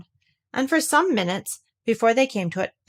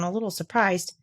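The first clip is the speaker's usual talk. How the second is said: usual speaking voice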